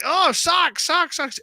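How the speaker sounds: high, loud gleeful chant; mock excitement. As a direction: whoop it up like excited kids. Family acting up over gift socks.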